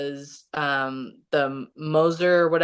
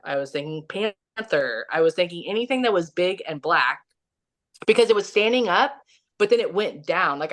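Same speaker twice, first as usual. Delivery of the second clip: usual speaking voice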